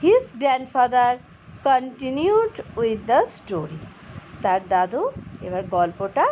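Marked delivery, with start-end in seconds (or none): 0.0-3.8 His grandfather continued with the story, very slow; slow model reading. Shown as a demonstration. Teacher slowly reading the next line to students. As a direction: read it out slowly for learners. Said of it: "next line".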